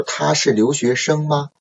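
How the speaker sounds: 他是留学生吗 with a clear rise on 吗; rising question intonation. Shown as a demonstration. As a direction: finish 吗 on an upward lift. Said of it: "into a, a upcoming intonation".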